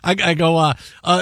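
normal talking voice

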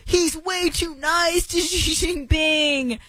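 high, slow mocking whine; mocking critics. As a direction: parody the outraged critics. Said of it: "the immediate response from people is like".

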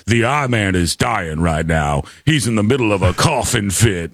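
deep dramatic clip announcer. Retold or imitated. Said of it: "the Don Imus one was like".

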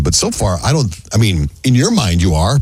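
ordinary delivery

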